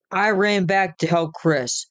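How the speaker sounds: ordinary delivery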